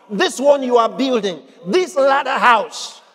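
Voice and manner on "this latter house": slow, higher "this", punched before "latter house"; stress on THIS. Shown as a demonstration. Preacher insisting on the one being built, stress on "this". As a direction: drive "this" home, drawing the phrase out. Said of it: "I emphasized the word "this".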